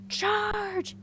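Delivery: high ringing battle yell. A narrator's reading. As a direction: lead the charge. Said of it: "yelled the Stone Prince".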